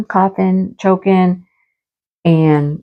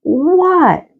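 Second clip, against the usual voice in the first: bewildered what; 9 semitones higher than usual. Retold or imitated. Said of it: "And I'm like".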